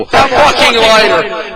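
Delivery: high, drawn-out yell for a lighter; desperate yell. Retold or imitated. Pushing through the crowd, begging strangers for a lighter, pleading yell. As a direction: call out across the crowd, pleading.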